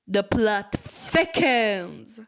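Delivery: loud, very slow dramatic proclamation; ominous, stretched-out announcement; dramatic suspense. Acted. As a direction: draw it out like a huge twist. Dramatic twist in the post.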